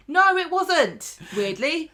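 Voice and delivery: high, loud mock protest; indignant retort. Actor disputing his minimum episode count, mock indignant. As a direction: stretch out an outraged imitated denial. Acted comeback from Sam Robertson.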